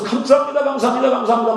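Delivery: overwhelmed, tearful gratitude; ecstatic thanks. Retold or imitated. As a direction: pour out thanks in rapture. Sister's ecstatic thanksgiving prayer.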